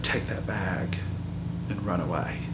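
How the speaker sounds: low, soft urgent warning; a dying woman's hushed urging. Retold or imitated. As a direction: quietly press her to flee. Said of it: "she said".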